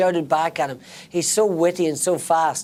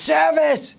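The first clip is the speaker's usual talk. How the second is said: high, loud call across the restaurant; shout; 11 semitones higher, 4 dB louder than usual. Real, not acted. Told to shout louder, calling service to the kitchen loud. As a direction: bellow it so the whole room hears. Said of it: "No, shout louder".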